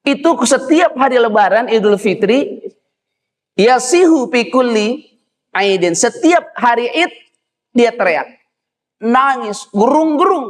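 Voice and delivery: high-pitched, ringing declamation; impassioned preaching. Acted. Preacher telling congregation how Iblis wails every Eid, declaimed high. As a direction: declaim it high, stretching the key words.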